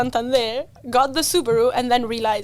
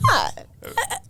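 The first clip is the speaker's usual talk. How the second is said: stunned gasp; 12 semitones higher than usual. Retold or imitated. Mom hears race car plan.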